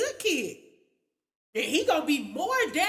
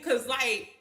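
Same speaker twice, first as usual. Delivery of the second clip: ordinary delivery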